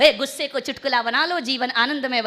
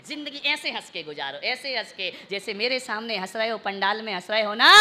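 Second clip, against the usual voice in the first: ordinary talk, nothing marked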